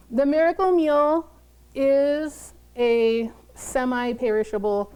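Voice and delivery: usual speaking voice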